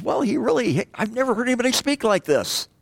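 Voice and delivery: high, awestruck guard voice; awed guards. Retold or imitated. Guards reporting to the Pharisees, high and awestruck.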